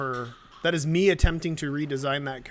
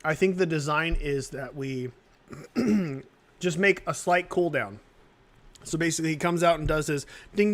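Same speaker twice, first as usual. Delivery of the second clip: usual speaking voice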